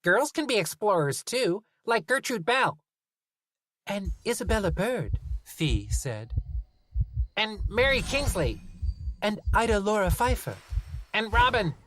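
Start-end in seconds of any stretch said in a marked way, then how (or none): 0.0-2.8 put-on high indignant girl; child voice. Talia child voice. 3.9-5.2 put-on high kid voice; child voice. Fee child voice. 7.4-11.7 put-on high eager kid voices; children piping up in turn. Children chiming in.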